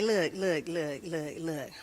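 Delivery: low, quiet warning; hushed stern warning before church; stern auntie voice. Retold or imitated.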